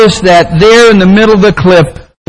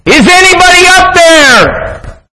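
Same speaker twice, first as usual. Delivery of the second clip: desperate yell; 13 semitones higher than usual. Acted. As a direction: yell for help at full pitch. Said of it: "he looked up and he yelled".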